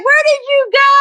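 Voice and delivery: light imitation of a baffled friend; mock puzzled friend. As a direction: play Mary calling out in surprise. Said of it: "She's like".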